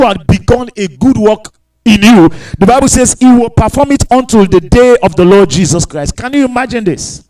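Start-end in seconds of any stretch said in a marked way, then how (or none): none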